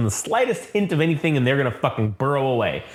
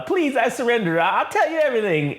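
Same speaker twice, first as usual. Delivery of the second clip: mock pleading; 9 semitones higher than usual. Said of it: "and he's like".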